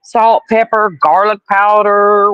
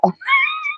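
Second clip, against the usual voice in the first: very high soft squeal of laughter; shrieking giggle; 7 dB quieter than usual. Real, not acted. Laugh after dog leaves.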